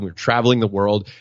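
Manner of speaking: usual speaking voice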